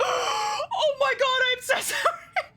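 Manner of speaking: high, drawn-out wailing apology; squealed re-enactment of being horrified; mortified retold apology. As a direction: wail it apologetically through laughter. Re-enacts horrified apology through laughter.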